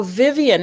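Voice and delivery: "Vivian" as VIV-ee-un, stress up front; common mispronunciation. Retold or imitated. People correcting her wrongly, "Vivian" said VIV-ee-un. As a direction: say "Vivian" in three syllables, first one punched. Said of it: "people mispronounce".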